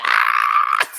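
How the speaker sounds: loud shriek breaking into laughter; scream, then cackle high; delighted scream. Retold or imitated. Reacting to mom's accent coming out.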